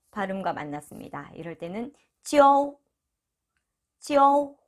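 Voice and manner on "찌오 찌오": 찌오 held high and level; one long, even high 찌오; model pronunciation. Shown as a demonstration. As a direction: glide 찌 into 오 without dropping pitch. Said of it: "라고 읽으시면 되고요".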